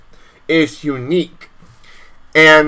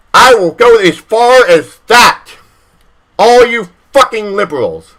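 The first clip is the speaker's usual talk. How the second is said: heated outburst; 4 semitones higher than usual. Real, not acted. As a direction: let it boil over at the liberals. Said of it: "I got heated".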